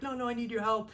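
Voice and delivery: high, urgent mock plea; retold yelling. Brother insisting sister help him, retold high-pitched yell. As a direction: cut in insistently, pitched well up. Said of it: "So I'm yelling".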